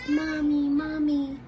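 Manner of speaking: loud, high call for Mommy; imitating a toddler's yell. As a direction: call out like a toddler in her crib. Child yelling for mom.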